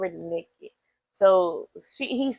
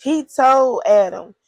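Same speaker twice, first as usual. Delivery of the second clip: loud build-up; 4 semitones higher, 10 dB louder, slower than usual. Real, not acted. Building up to the curse.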